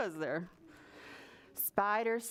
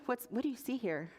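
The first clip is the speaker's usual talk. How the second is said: plain reading voice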